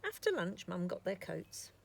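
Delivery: ordinary delivery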